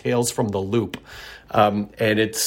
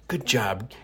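put-on mild older-folks praise; 2 semitones lower, 7 dB quieter than usual. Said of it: "as us older folks say".

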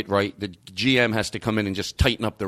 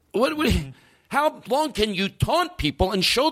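exasperated outburst; 9 semitones higher than usual. Real, not acted.

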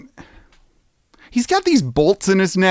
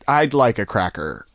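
ordinary talk

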